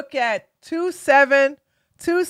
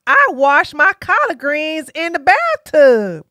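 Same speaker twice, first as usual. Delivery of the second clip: higher, louder mock Southern auntie voice; folksy Southern accent; 5 semitones higher, 4 dB louder than usual. Candidate pandering about soul food, hammed-up Southern accent. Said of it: "She keep trying to talk about".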